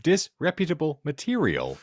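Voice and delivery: usual speaking voice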